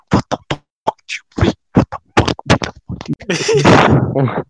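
hammed-up retold exasperation; exasperated outburst. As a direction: blurt it out, stretching the last words.

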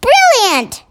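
high, loud queenly approval; delighted exclamation. A narrator's reading. Queen praises the giant's plan, high and delighted.

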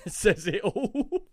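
laughing through the words; chuckling while repeating it. Real, not acted. Laughing echo of friend.

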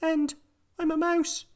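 very high squeaky mouse; tiny mouse voice. A narrator's reading. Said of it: "said a small furry creature".

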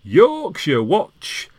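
slow, high, drawn-out announcer-style call; jingle call. Shown as a demonstration. Announcing a podcast segment, slow high jingle call. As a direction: sing out the segment name like a jingle. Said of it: "every now and then I might go".

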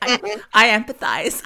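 high, loud and slow put-on voice; over-earnest mock Lisa, drawn out; Lisa impression. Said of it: "I can't do her voice".

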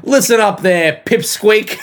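hammed-up challenge on the edge of laughing; mock confrontation. Play-acts insulting someone.

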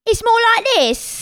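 high, slow fake British accent; drawn-out, high-pitched British imitation; put-on British accent. Correcting a friend's version of teenagers' fake British, in that accent. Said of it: "talking in a British accent".

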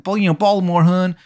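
high Maryland voice with exaggerated O's; hammed-up Maryland "hun"; bad Maryland accent. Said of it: "when he's talking about the O's".

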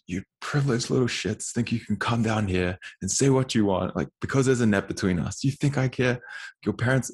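heated retelling of telling someone off; retold angry rant. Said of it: "I look like an idiot screaming".

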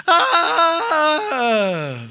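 long high wailing "aah"; loud drawn-out comic scream, high then sliding down; mock scream. Pastor reacting to too much information, long mock scream. Acts out reaction to hearing confessions.

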